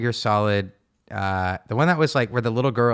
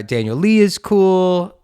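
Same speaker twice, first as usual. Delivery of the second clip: emphatic; 6 semitones higher, 6 dB louder, slower than usual. Real, not acted. Emphatic endorsement of candidates.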